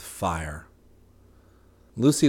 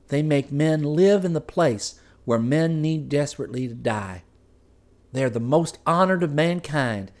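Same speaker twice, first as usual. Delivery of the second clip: higher wife's voice reciting a familiar creed; rote reassurance; 5 semitones higher than usual. Acted. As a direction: recite it like a tale told countless times. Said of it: "like a tale told a thousand times".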